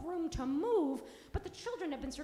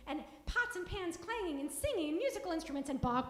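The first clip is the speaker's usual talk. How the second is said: usual speaking voice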